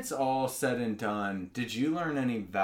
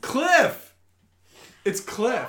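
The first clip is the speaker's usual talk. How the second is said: high, loud outcry; excited exclamation; 13 semitones higher, 8 dB louder, much slower than usual. Real, not acted. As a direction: blurt the name out. Correcting the name excitedly.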